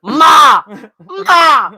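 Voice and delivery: high, loud holler for Ma; calling out. Pretend. Friend playfully calling for Ma, hollering.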